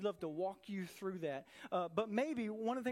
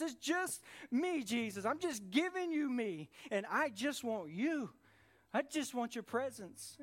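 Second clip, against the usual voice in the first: fervent prayer; 3 semitones higher than usual. Pretend. Worshipper pleads to Jesus with rising fervour. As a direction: pour out the prayer with rising urgency.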